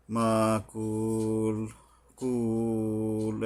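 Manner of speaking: "মাকুল, কুল" with the u held very long; slow chanted "মাকুল, কুল"; long madd stop. Shown as a demonstration. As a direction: stretch the u of "কুল", then stop. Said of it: "তিন আলিফ টান নিয়ে তারপরে থামতে হবে".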